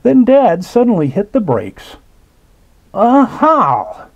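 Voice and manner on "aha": high, bright "Aha!" of discovery; excited cry. A narrator's reading. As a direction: call it out like a sudden find. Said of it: "he cried".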